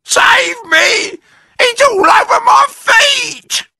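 very high, loud terrified crying out; screaming. A narrator's reading. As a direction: shriek in panic. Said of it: "screamed Missus Twit".

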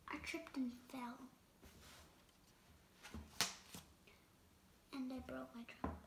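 soft whispered confession; sad whisper. Acted. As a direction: let it drop to a sorry whisper. Gerald's sad punchline.

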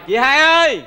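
loud call across the house; calling loudly for the elder sister. Acted. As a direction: shout her name to fetch her.

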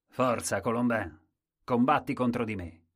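booming, loud dare; a strong courtier daring Colombin, loudly; loud challenge. Acted. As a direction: call him out to fight, loud and bold.

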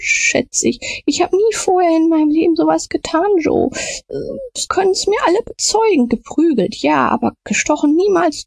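very high, desperate begging; terrified pleading. A narrator's reading. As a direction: beg on your knees, high and frantic. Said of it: "fiel auf die Knie ... hob beschwörend die Hände". (hoch, verzweifelt flehend)

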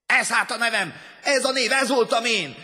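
high, loud cry of discovery; exultant shout. A narrator's reading. Man who just learned his name, exultant shout. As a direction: shout it in revelation.

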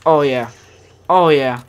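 loud, satisfied oh yeah; real gamer pleasure, loud but in normal pitch; loud delight. Real, not acted. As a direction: relish it out loud. Savoring a gun while playing.

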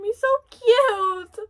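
high, loud, drawn-out coo; cute-struck gushing. Real, not acted. Fan seeing him so cute, high slow gushing. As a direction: gush over him, stretching it out.

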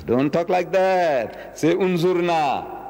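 slow, weighty instruction; solemn command. Acted. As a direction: deliver the rule slowly, word by word.

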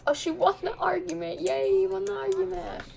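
high, thin celebratory voice; excited squeaky cheer. Pretend. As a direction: cheer for her win in a squeaky voice.

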